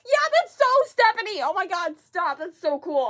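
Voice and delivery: high, loud fangirl excitement; thrilled outburst. Real, not acted. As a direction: burst out with delighted confirmation. Confirms the actress, thrilled.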